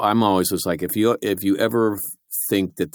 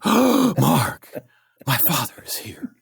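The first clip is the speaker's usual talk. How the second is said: mock fright; 6 semitones higher, much slower than usual. Acted scene, gasp then whisper.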